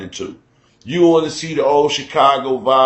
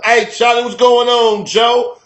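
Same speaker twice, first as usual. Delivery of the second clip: much higher, louder Chicago lilt; sing-song Chicago greeting, pitched up; 10 semitones higher, 5 dB louder than usual. Shown as a demonstration. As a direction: hit that Chicago tone when greeting. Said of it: "a certain tone that we hit".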